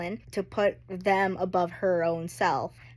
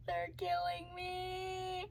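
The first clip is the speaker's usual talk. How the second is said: higher, slower, much softer whimper; tearful, fading; 11 semitones higher, 10 dB quieter, much slower than usual. Real, not acted. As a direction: let it die away in tears. Second take, softer.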